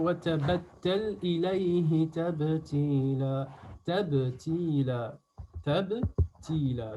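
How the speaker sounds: slow, measured recitation with long held vowels; drawn-out teaching recitation; Quran recitation. Shown as a demonstration. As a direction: recite for pupils, syllable by syllable. Recites the verse.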